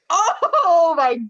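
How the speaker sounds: slow, high laughing oh my God; laughing disbelief. Retold or imitated. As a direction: drag it out through a laugh. Reacting to the comeback line.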